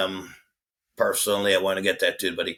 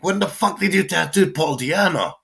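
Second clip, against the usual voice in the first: much higher, louder stunned question; shocked disbelief; 10 semitones higher, 4 dB louder than usual. Retold or imitated. Quoting his reaction to a friend's new tattoo, high shocked outburst. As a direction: blurt it out in shock. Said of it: "I'm like".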